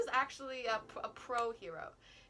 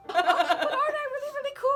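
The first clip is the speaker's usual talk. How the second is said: very high, loud, laughing whine; high squeaky show-off; 14 dB louder than usual. Pretend. Vain shiny boy squealing at classmates for praise, laughing.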